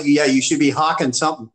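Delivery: normal talking voice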